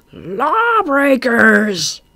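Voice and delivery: high, very slow, loud shriek; the owl shrieking at the bats. A narrator's reading. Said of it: "shrieked the owl".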